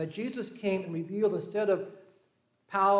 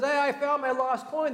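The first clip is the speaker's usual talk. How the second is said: higher, louder, excited announcement; excited call to neighbors; 8 semitones higher, 4 dB louder than usual. Acted. Woman calling her neighbors, thrilled about her coin. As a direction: she rings out the news, overjoyed. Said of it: "calls her neighbors and says".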